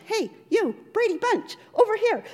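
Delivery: high, loud street-vendor call; pushy vendor shouting at the tourists; vendor yelling. Retold or imitated. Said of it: "one yelled".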